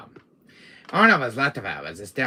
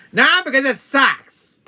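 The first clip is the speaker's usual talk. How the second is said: loud, high snap back; exasperated comeback at full volume; angry retort; 12 semitones higher, 13 dB louder than usual. Acted. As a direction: shout the put-down. Snapping back about the voice.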